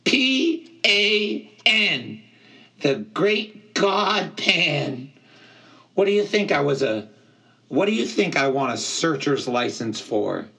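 high satyr voice, loud spelling easing into exasperated questions; affronted, high-pitched outburst; indignant. A narrator's reading. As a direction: spell it out hard, then protest. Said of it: "he cried indignantly".

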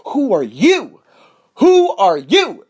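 loud, high-pitched self-challenge, building; emphatic challenge. Acted. Host calling himself out mid-rant, emphatic challenge.

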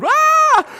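very high, loud comic shriek; mock scream. Preacher re-enacting his scream at a snake in the house, hammed up. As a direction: let out a panicked scream, hammed up. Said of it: "and then I screamed".

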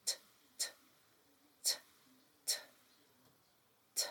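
T said as a short unvoiced t, t, t; t sound repeated. Shown as a demonstration. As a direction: tap out the T sound, t, t, t. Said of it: "phonics sound demo".

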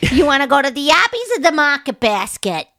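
put-on teasing Boston accent parody. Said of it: "the worst accent in all of the United States".

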